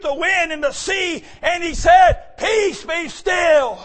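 loud, high preaching, very slow on "Peace, be still"; preaching at full force. Acted. Preacher calling out Jesus calming the storm to the church. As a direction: draw out the command to the sea.

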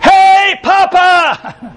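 put-on high, drawn-out little-kid call; grandson's excited greeting. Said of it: "I heard this voice saying".

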